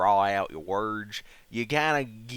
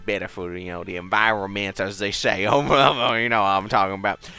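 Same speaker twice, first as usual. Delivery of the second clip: put-on Southern accent. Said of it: "give me your best Southern accent".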